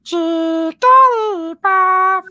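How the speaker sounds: high, slow squeaky Pokemon cry; sing-song little creature call; Jigglypuff voice. Retold or imitated. Jigglypuff call for a delighted friend. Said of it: "Oh my God. That's adorable".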